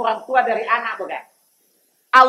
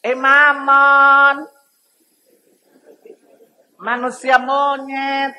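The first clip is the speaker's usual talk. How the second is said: higher, slow, drawn-out cheeky monkey; 3 semitones higher, 3 dB louder, much slower than usual. Acted. Preacher playing a monkey answering an angry man, slow smug taunt. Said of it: "Apa kata monyet?".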